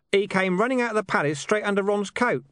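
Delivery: high-pitched character voice. A narrator's reading. Hidden speaker makes excuses for an old dog, explanatory. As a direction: explain it from hiding, pitched well up.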